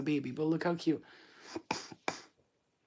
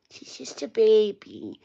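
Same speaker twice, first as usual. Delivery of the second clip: slow, drawn-out coo over a pet; 3 semitones higher, slower than usual. Real, not acted. Repeats it cooing over the dog.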